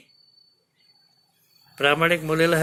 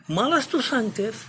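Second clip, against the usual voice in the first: much higher, indignant retort; angry king; 10 semitones higher than usual. Acted. King rebukes Regan for siding with her sister, indignant. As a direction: hurl it back as an insulted father. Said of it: "आता आला आणखी राग".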